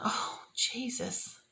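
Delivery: slow, breathy near-whisper; weary hushed prayer. Retold or imitated. Speaker re-enacts turning to Jesus when shut down, hushed prayer. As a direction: sigh the name out softly, drawn out.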